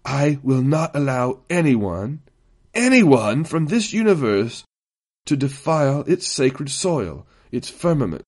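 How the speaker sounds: slow, deliberate intensity, rising on the repeat; drawn-out, fervent warning; intense. A narrator's reading. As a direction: weigh each phrase with zealous intensity. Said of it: "his voice growing even softer but even more intense".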